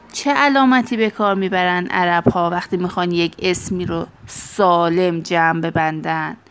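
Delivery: ordinary talk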